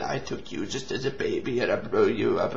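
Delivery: low, soft menacing alien; hint of a sinister alien speaking low; alien villain voice. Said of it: "He said".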